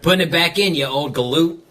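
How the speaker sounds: lightly Aussie, laughing midway; mock Australian, breaking into a laugh; amateur Aussie voice through laughter; Australian accent. Shown as a demonstration. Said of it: "when Australian".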